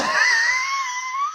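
long squealing laugh trailing away; high wheezing laugh. Real, not acted. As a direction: laugh until only a high wheeze is left. Laughing at his own imitation.

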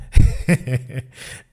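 real unvoiced laugh, air only; wheezing amused laugh; breathy laugh. Real, not acted. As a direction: laugh silently through the breath. Laughing at own booking mix-up.

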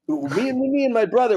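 stammering ferocious character, high and loud; Bob Goldthwait. Retold or imitated. Comic imitating friend's stuck storytelling, ferocious stammer. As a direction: get stuck on the first word, loud and high. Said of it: "would take him forever to say".

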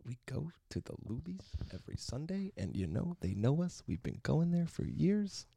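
soft, halting delivery with lines swinging up high; odd professor's voice. Retold or imitated. Student mimicking a professor's Sunday pie story, quiet and singsong. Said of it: "the way he would talk about it like this".

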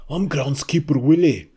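loud hammed-up Scottish accent; Groundskeeper Willie. Asked to try Scottish, a loud cartoon Scottish accent. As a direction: belt out a cartoon Scot. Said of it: "Try to do a Scottish accent".

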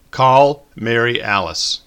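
slow, loud, every word spelled out; slow command. A narrator's reading. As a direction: enunciate carefully for the phone. Slow deliberate command to phone.

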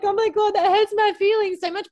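high and loud exaggerated hurt; mock hurt. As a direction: ham up wounded feelings. Mock wounded reaction retold.

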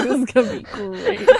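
giggling through the words; laughing Dumbledore. Retold or imitated. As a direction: chuckle it out as Dumbledore. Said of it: "Dumbledore's like".

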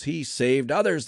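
slow, high, loud scoff. A narrator's reading. Religious leaders mocking Jesus, scoffing. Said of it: "they scoffed".

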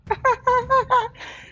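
high squealing laugh; high burst of laughter. Real, not acted. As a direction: let out a high, loud laugh. Laugh after dramatic opening.

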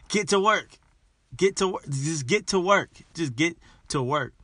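loud, high rallying call to get to work; shouted pep talk. Real, not acted. Podcaster rallying his listeners, loud and pumped. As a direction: fire them up, hammer it home.

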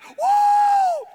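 shrieking very high, loud whoa; startled whoa. Retold or imitated. Disciples seeing Jesus appear, shrieked whoa. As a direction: yelp in shock. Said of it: "All the other disciples are like".

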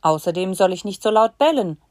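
ordinary talk, nothing marked

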